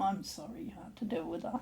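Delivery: soft unvoiced aside, quick; quiet quick whispered sorry; whispered apology. Wife's mock apology for her sister.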